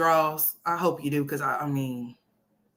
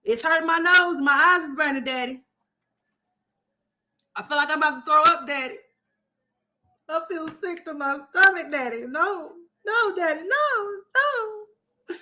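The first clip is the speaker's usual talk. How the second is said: put-on much higher, whiny crying kid begging daddy; mock crying child; 10 semitones higher, 4 dB louder than usual. Child crying to daddy about mama's smell, high mock wail. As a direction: wail to daddy like a sobbing little kid, climbing to a cry. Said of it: "ran to my daddy crying like a motherfucker".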